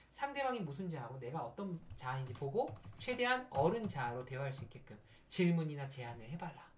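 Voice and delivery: ordinary talk, nothing marked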